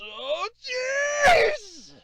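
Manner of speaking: high, exhausted groan; climbing stairs. Pretend. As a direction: groan out of breath at the top. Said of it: "why are there so many stairs?".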